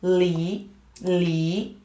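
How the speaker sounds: "Li" starting low and gliding up; rising tone. Shown as a demonstration. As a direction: sweep each "Li" upward. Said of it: "The tone is rising".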